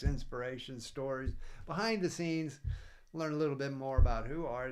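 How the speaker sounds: upbeat podcast outro; show outro. Acted. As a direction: wrap up the show like a host.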